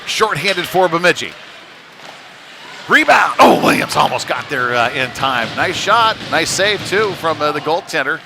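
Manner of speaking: loud, high breakaway call; excited play-by-play. Real, not acted. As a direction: burst out on the big chance, then ease off. Live call of shorthanded breakaway.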